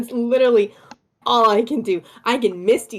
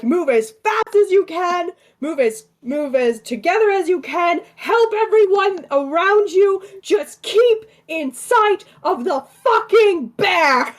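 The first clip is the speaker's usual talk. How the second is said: high-pitched in-character rallying cry; pretend yell; 7 semitones higher than usual. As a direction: call urgent orders down the line, slowing at the end. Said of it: "I'm going to yell down the line".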